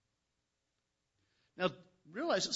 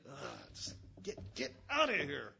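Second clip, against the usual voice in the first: annoyed groan. Retold or imitated. Annoyed big brother.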